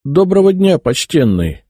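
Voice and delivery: fawning, overly sweet greeting; butter him up with a syrupy hello; sweet-talking. A narrator's reading. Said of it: "Скроил я умильную физиономию".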